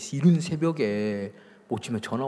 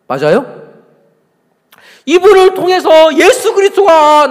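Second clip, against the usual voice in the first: loud, high, drawn-out pulpit delivery; forceful preaching; 13 semitones higher, 13 dB louder than usual. Real, not acted. Preacher putting a challenge to the congregation, forceful preaching. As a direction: drive each phrase home at full voice.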